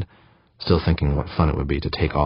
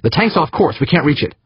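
high-pitched angry report; angry, aggravated; 12 semitones higher, 4 dB louder than usual. A narrator's reading. Crewman reporting the lost tank, angry and aggravated. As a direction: snap it out in exasperation. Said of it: "male, angry and aggravated".

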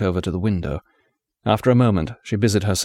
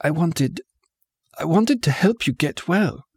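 higher, choked, breaking on the words; strangled voice; 7 semitones higher than usual. A narrator's reading. A young woman pleading with the wounded man, voice strangled. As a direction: force the words through a tight throat. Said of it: "Her voice strangled".

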